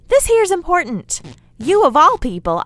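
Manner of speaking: exasperated scold. Acted. Scolding the listener.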